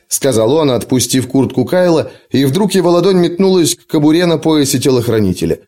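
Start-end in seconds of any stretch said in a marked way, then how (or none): none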